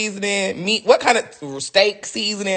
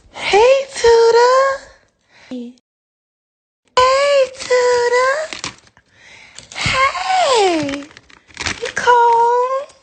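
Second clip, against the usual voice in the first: put-on very high, cooing baby-talk voice; sing-song high baby talk; baby talk to meat; 4 dB louder, much slower than usual. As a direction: coo at the thawing meat like a pet.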